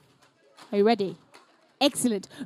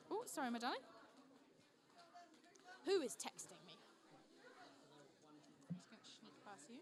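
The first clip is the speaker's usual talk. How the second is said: ordinary talk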